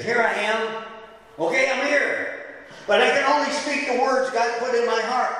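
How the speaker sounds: high, sarcastic reply to the king. Retold or imitated. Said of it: "really sarcastically".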